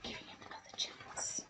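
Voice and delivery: soft, quick whispered aside. A narrator's reading. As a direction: murmur approval under your breath. Aside to listeners.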